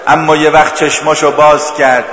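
loud grieving pulpit voice; sermon narration of the imam's death, loud; impassioned mourning narration. Acted. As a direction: tell the martyrdom with rising grief. Continues mourning narration at full force.

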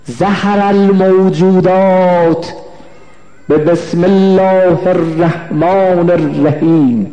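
slow, drawn-out Arabic recitation; measured, sustained chant-like citation; solemn recitation. Real, not acted. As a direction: intone it slowly, stretching the long vowels. Recites a quoted Arabic tradition.

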